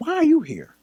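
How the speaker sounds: high, shocked question; imitating his own surprise; startled. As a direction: caught off guard by mom. Surprised seeing his mom.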